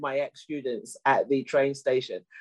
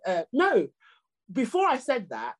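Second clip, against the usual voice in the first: ordinary delivery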